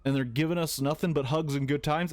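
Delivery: ordinary delivery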